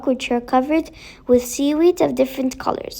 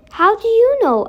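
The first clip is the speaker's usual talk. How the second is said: put-on a much higher, wide-eyed boy's question; surprised boy; 9 semitones higher, 3 dB louder than usual. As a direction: ask it like an astonished child. Tom questions the fairy.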